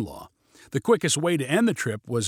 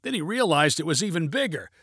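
usual speaking voice

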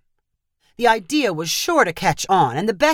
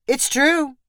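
higher, smug and oily; smarmy; 7 semitones higher than usual. A narrator's reading. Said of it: "Sampo replied in a smarmy voice".

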